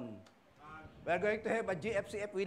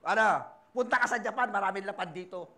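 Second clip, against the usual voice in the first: brother calling; 8 dB louder than usual. Retold or imitated.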